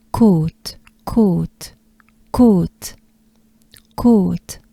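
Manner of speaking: côte with a closed o, clipped t; model côte. Shown as a demonstration. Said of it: "and if you're new".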